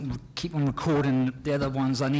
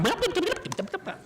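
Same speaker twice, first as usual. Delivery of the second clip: high laughing blah-blah; mock babble. Actor mocking his own excited chatter, high babble. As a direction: gabble it playfully, high. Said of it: "And there's me going".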